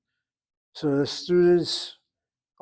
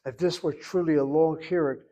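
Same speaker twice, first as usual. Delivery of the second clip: ordinary talk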